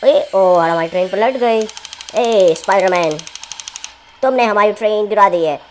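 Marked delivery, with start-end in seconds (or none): 2.1-5.6 loud mock telling-off; pretend-angry scolding of a toy; playful scolding. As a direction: shoo the toy away, louder and louder. Scolding toy Spiderman for train.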